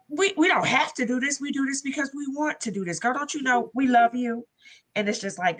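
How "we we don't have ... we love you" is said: higher-pitched mother's loving scolding; retold motherly reassurance, raised pitch; imitating insistent mother. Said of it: "and my mother's like".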